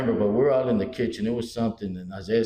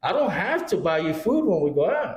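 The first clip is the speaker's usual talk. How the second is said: mock indignant; 7 semitones higher than usual. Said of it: "I go".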